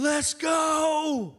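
high, drawn-out shout; imitated fan yell. Preacher imitating a really happy fan, yelling. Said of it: "But he's yelling".

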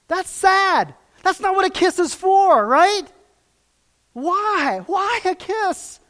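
high, loud cry of sorrow; loud lament. Real, not acted. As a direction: call it out high and loud, grieved. Preacher exclaims over Judas kiss.

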